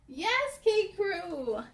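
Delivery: ordinary delivery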